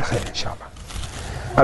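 slow, hushed whisper to himself; whispered resolve. Retold or imitated. The grateful man whispers his resolve after hearing the news. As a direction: breathe the words slowly, almost to yourself.

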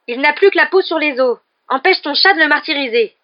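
loud, high accusing protest; heated, angry complaint; furious. A narrator's reading. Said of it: "dit-il avec fureur".

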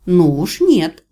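loud, indignant refusal. A narrator's reading. Heroine refusing to be left alone in the dark house, indignant. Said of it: "возмутилась я".

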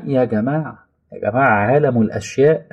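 usual speaking voice

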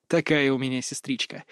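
plain reading voice

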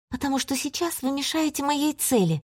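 put-on high, firm girlish voice; a girl standing her ground; young girl voice. Listera answers, high.

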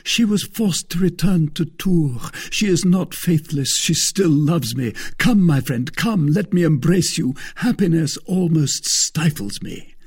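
high, loud, overjoyed outpouring; near delirium. A narrator's reading. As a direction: pour it out delirious with happiness. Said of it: "almost in a state of delirium".

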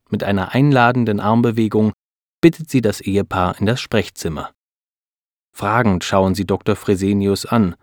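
plain reading voice

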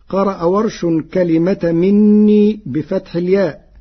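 ordinary talk, nothing marked

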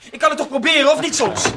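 defiant shout during the quarrel; angry shout. Acted. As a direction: yell it at him, fed up. Quarrel, Ray shouting back.

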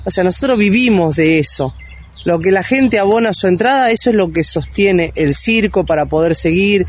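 normal talking voice

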